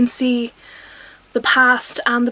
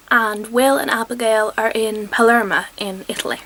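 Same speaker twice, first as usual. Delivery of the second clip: normal talking voice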